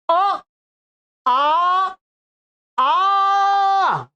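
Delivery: अ short, then आ stretched long and rising to a held shout; prolonged pluta vowel. Shown as a demonstration. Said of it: "अब मैं आपको इसका उच्चारण करके बताता हूं".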